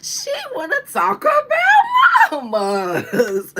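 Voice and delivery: high, slow, loud laughing shriek; laughing shout. Retold or imitated.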